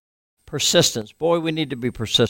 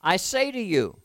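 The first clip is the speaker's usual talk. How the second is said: "say" raised and pressed, the rest falling away; stress on SAY; 6 semitones higher, 3 dB quieter than usual. Shown as a demonstration. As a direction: lean on "say" to drive it home. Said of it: "Are you listening?".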